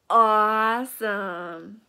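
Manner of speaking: drawn-out awesome. Acted. Cat delighted by glasses.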